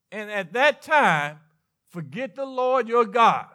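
usual speaking voice

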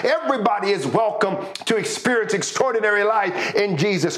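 high, rousing preacher's cry; preaching at full force. Acted. Preaching builds to a climax.

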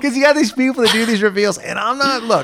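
high, loud gushing about reveals; breathless fan gushing; gushing excitement. Real, not acted.

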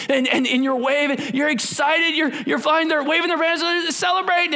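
preacher getting fired up; excited. Real, not acted. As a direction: build the joy like a crowd. Excited description of celebration.

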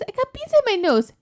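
put-on high, small child voice; little kid voice. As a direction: say it like a toddler owning up. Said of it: "And she goes".

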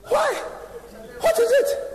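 high, loud, laughing surprise; startled question. Retold or imitated. Preacher startled by friend's shout, laughing high. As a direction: squeal it out half-laughing in shock.

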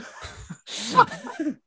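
squeaky burst of giggles; helpless high giggling; high-pitched giggle. Real, not acted. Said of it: "Stop laughing".

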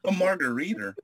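mock accent turning "margarita" into "margariter"; added final R. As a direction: end "margarita" on a hard ER. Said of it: "A's at the end are like E Rs".

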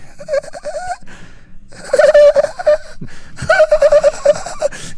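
high, insistent mock cackle; imitated laughter. The laughing he keeps hearing, high-pitched cackle. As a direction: giggle on and on, very high. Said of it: "he still keeps hearing".

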